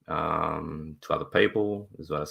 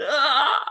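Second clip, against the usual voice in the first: mock gasp; 5 dB louder than usual. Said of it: "And I just went".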